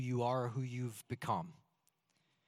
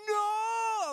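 much higher mock wail; anguished cry; 4 dB louder than usual. Dad mocks his heartbroken cry at his daughter's words.

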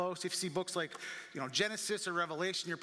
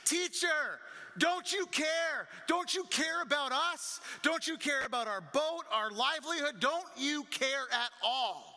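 desperate yelling; 7 semitones higher than usual. Acted. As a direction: yell it over the storm. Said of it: "and they're yelling".

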